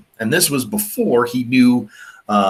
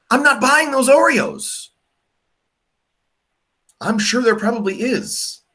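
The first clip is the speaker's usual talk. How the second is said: incredulous; 12 semitones higher than usual. Real, not acted. Rejecting dirt-flavored Oreos.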